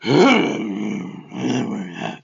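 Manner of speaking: loud, low throaty growl; exaggerated Bale growl. Mocking the extreme Batman voice, loud low growl. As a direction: growl wordlessly, hammed up. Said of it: "You ain't gotta be like".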